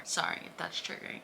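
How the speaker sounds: a hushed, sheepish aside; whispered aside. Real, not acted. Vlogger apologises to viewers for a grim image, whispered aside. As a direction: whisper the apology under your breath.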